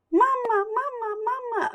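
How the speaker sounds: high squeaky duckling calling; baby duck. Acted. Duckling calling Pip its mother, squeaky and high. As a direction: cry out like a newborn duckling.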